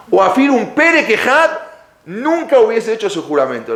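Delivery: high, emphatic retold avowal, slowing then driving on. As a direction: swear it with force, pitched well up. Father-in-law's emphatic regret. (declaración imitada, enfática y aguda)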